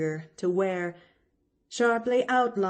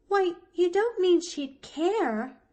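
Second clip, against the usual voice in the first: put-on high, louder troubled child's question; disturbed cry; 12 semitones higher, 5 dB louder than usual. A narrator's reading. Pollyanna fears her aunt would care, plainly disturbed. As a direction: cry out in dismayed surprise. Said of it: "cried Pollyanna, plainly disturbed".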